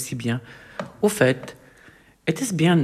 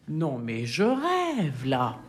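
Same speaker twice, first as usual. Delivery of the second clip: stunned disbelief; 4 semitones lower, 6 dB quieter, much slower than usual. Acted. Disbelief underwater.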